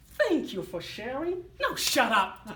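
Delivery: mock-polite thanks, then loud now shut up; telling voice off. Telling his inner voice to stop, polite then loud. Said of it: "I say to my voice again".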